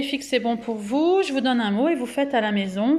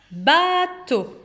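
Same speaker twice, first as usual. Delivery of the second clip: "bateau" high, with a long held "ba", then "teau"; high, drawn-out "baa-teau"; syllable by syllable; 7 semitones higher, 4 dB louder than usual. Shown as a demonstration. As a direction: stretch and separate the syllables of "bateau". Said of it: "combien de syllabes".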